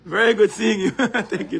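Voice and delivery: laughing greeting. Real, not acted.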